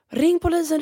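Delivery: hög, ljus ropande kvinnoröst; återberättat skrik. Retold or imitated. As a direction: ropa på hjälp som den arga kvinnan. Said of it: "hon börjar skrika till mig på spanska". (kvinnan på gatan ropar på polisen, högt och gällt)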